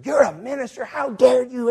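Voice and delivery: high, loud imitation of indignation; mock outrage. Older lady scolding the minister, loud mock outrage. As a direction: explode in indignant disbelief.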